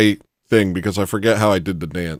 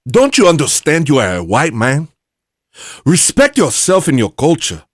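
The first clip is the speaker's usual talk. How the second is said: put-on loud, higher-pitched African accent; 5 semitones higher, 5 dB louder than usual. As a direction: scold in a put-on African accent, punching the words. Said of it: "I would use an African accent".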